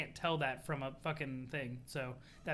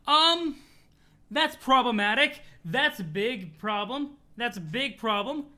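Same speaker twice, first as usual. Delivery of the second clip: loud, higher-pitched dismay; real alarm; 5 semitones higher, 10 dB louder, slower than usual. Real, not acted. Reader discovering a big problem in the story, alarmed. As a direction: react aloud as the trouble sinks in.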